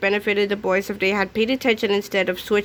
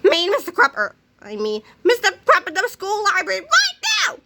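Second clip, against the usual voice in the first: angry shout; 9 semitones higher than usual. A narrator's reading. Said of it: "he shouted".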